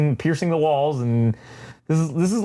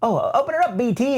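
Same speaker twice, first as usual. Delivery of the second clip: high, sing-song droid voice; Triple Zero voice; 9 semitones higher, faster than usual. Retold or imitated. Triple Zero orders B T to open the door, high and sing-song. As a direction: chirp it like the cheerful killer droid. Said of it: "of course".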